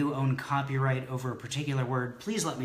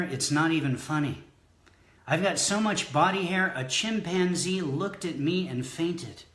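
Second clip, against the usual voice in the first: raised, emphatic poetry-recital delivery; comic poem reading; 6 semitones higher than usual. Acted. Poet reads a body-hair poem to the audience, mock-grand recital. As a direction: declaim each boast in a higher, rhythmic refrain.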